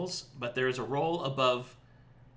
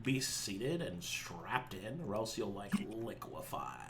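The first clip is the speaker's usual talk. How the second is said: quiet; 4 semitones lower, 11 dB quieter, slower than usual. Acted. GM warning of ship launch.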